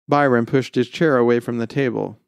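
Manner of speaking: plain reading voice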